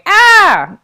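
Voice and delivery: loud, high mock "Ah" scream; retold scream. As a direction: shriek like a scared little kid.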